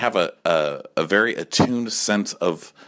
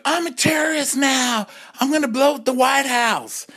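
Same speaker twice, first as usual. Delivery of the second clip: mock [name]. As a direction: play [name] as a wild diva. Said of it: "the [name]'s like".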